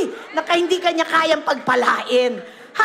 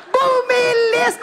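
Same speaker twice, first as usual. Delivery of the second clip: higher, drawn-out cry of delight; excited exclamation; 6 semitones higher, much slower than usual. Retold or imitated. Pastor tells how the car sped up, excitedly. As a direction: stretch it out in joyful amazement.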